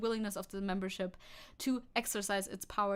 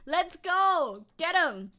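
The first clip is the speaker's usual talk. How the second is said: cheering; 5 semitones higher, 8 dB louder, much slower than usual. Real, not acted.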